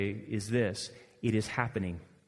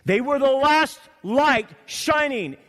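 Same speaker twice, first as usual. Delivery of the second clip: loud, slow, high preaching reading; preaching scripture; 12 semitones higher, 9 dB louder, slower than usual. Acted. Preacher reading God's words to Samuel, booming and slow. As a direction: thunder God's words out slowly.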